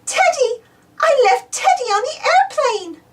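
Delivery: put-on high, upset little girl; mild panic, remembering Teddy; alarmed Peppa. A narrator's reading. Peppa realises Teddy is lost, high alarmed voice. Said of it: "Peppa remembers something very important".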